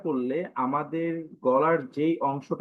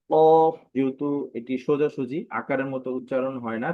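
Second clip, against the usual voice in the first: normal talking voice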